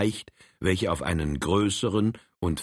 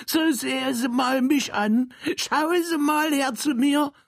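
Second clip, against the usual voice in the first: high, eager little Saxon man. Acted. Saxon character boasts to Frank, high and eager. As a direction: squeak it out like a proud little Saxon.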